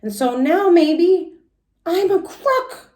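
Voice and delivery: put-on high, slowing little-girl worry; Junie fretting. Junie alone in her room, fretting in a high voice. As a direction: whine it to yourself like a worried kid. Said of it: "I said".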